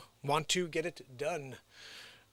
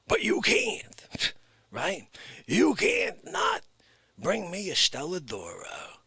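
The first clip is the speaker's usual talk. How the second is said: wiseguy on the phone to heaven; mock mobster on phone. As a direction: play a tough guy sweet-talking Saint Peter on the phone. Mock phone call as mob guy.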